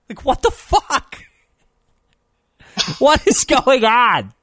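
high, slow, laughing incredulity; laughing disbelief. Real, not acted. Reacting to the homophobic dog, laughing high.

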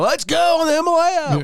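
loud, high-pitched carny holler; obnoxious ride operator. Retold or imitated. Ride worker calling out the Himalaya, obnoxious yelling. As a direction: announce the ride with over-the-top mock hype. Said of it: "you just gotta be real obnoxious".

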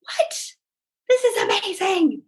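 high, excited gasp of disbelief; amazed disbelief. Retold or imitated. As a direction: sound stunned by free money. Thinking about free money.

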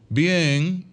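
acted half-hearted "fine"; unconvincing "bien". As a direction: say you're fine while your tone says otherwise. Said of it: "ni en el tono, ni en su expresión, está bien".

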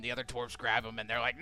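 normal talking voice

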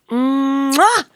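playful blown kiss; kiss sound. Shown as a demonstration. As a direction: blow a smacking kiss. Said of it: "Sending a hug and a kiss".

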